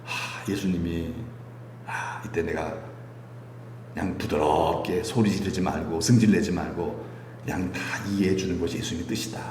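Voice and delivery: hushed, low and gentle; soft, gentle self-talk. Acted. Believer calming themselves during a family upset, soft and gentle. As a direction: talk yourself down softly, almost whispering at the end. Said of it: "아멘 하고 부드럽게 나가는 거예요".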